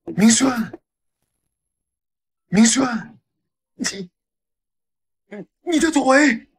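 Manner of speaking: high, loud shocked cries. Acted. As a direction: gasp out his name in disbelief. Father sees son's legs work. (震惊地高声惊呼)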